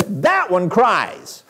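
exasperated retelling, heavily drawn out; irritated mother. Retold or imitated. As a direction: imitate a fed-up mom, drawing out each word. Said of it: "irritating her".

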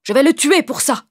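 high, loud, indignant outburst; angry. Acted. Wicked master cutting in on the old man, angry. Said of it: "L'autre homme les coupa avec colère".

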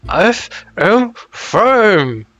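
booming through a mouthful of sand; mouth full. Acted. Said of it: "Your, your mouth's full of sand".

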